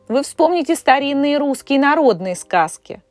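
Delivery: normal talking voice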